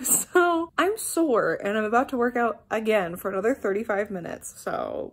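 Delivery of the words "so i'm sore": laughing. Real, not acted. Laughs about being sore.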